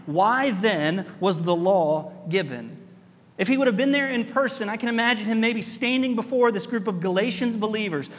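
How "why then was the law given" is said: slow rhetorical question. Shown as a demonstration. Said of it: "You can hear the pause in Paul's voice".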